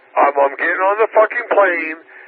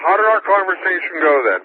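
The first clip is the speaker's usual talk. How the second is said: usual speaking voice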